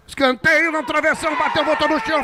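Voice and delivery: rising, urgent play-by-play into a shout; explosive goal commentary. Real, not acted. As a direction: build to a roaring call. Commentator calling a near goal. (narração empolgada de gol)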